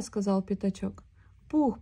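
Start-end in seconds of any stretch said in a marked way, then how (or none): 1.5-1.8 high worried call of a name; anxious. A narrator's reading. Piglet, nervous, edges closer to Pooh: worried call. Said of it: "продолжал он встревоженно".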